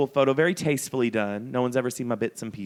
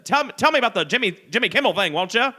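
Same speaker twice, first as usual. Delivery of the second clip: raised, stammering old-movie impression; mock transatlantic film actor, higher and louder; Wonderful Life guy; 8 semitones higher, 4 dB louder than usual. Co-host asks about the Jimmy Kimmel thing, old-movie actor impression. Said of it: "that one guy from A Wonderful Life".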